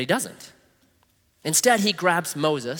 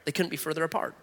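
quiet repeat; 9 dB quieter, faster than usual. Shown as a demonstration.

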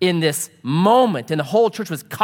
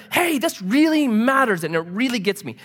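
higher, urgent mock appeal; passionate; 7 semitones higher than usual. What an unfeeling husband never says, passionate. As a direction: act out caring intensely. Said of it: "There's no passion. There's no".

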